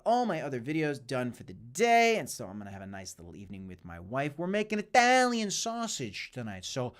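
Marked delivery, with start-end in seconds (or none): 4.3-6.9 put-on silly Italian accent. Streamer clowning for viewers about tonight's sausage dinner, silly Italian accent. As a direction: ham up a playful Italian accent. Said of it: "that silly Italian accent".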